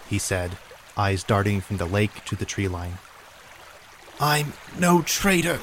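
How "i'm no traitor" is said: high, breathless, ragged gasps; hyperventilating. Acted. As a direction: force it out between ragged breaths. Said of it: "through ragged breaths".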